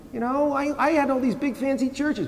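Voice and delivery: pitched high, whiny self-pleading; whining plea. Retold or imitated. Preacher pleading to Saint Peter about his fancy churches, high whine. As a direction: whine your credentials up high.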